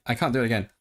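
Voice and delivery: ordinary delivery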